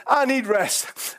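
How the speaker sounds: drawn-out tired plea; slowed, weary prayer; slow pleading prayer. Retold or imitated. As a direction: ask for rest, slowly.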